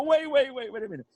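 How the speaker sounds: high-pitched amused interruption; can't stop laughing. Retold or imitated. Laughing patient interrupting the serious guard, amused. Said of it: "So I can't stop laughing".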